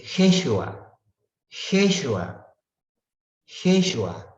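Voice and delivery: teacher modelling the Hebrew name "Jeshua" for students, slow; Hebrew name said carefully. Shown as a demonstration. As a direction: repeat "Jeshua" carefully so they hear the Hebrew. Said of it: "en hebreo".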